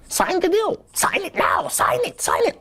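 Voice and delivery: demon voice. Retold or imitated. Manager on loudspeaker turning demonic, pushing the deal. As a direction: screech it like a possessed demon. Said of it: "a demon's voice".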